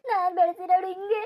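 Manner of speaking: wailing through sobs; a mother crying over a runaway daughter; sobbing lament. Acted. Mother wails to the nephew about her runaway daughter, sobbing.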